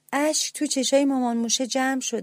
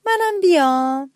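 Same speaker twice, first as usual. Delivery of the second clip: slow, high little-mouse voice asking shyly; little mouse begs his brothers to take him, timid and high; small mouse's plea; 5 semitones higher, 5 dB louder, slower than usual. A narrator's reading. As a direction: ask timidly to tag along, higher and slower. Little mouse asks to come along.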